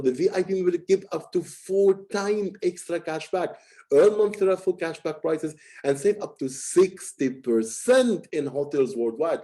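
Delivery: key phrases like "four times" and "sixty percent" lifted and stretched; emphatic, fluctuating tone. Shown as a demonstration. Coach demonstrating emphasis on important points, fluctuating tone. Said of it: "you need to emphasize For example".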